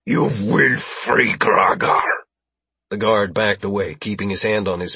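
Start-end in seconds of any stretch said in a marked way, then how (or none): 0.0-2.0 slow, forceful alien voice; drawn-out, menacing alien demand; alien's demand. Acted. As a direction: order it slowly as an alien captor. Alien hostage-taker demands release.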